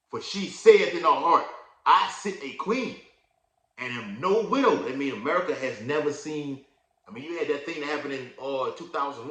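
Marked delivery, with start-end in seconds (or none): 0.1-4.8 loud, raised scripture reading; preaching scripture. Acted. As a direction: proclaim the verse to the congregation. Reads scripture aloud, preaching.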